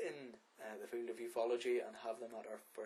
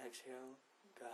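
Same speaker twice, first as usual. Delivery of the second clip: guided meditation; 14 dB quieter than usual. Acted. Podcast host guiding listeners through breathing, soft meditation voice. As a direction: lead the breathing gently with long pauses.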